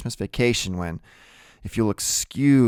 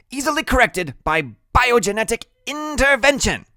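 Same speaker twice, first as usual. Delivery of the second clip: very high, louder know-it-all; high-voiced brainy kid; Freak's character voice; 5 dB louder than usual. A narrator's reading. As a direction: pitch it way up, pompous. Freak explains in big words.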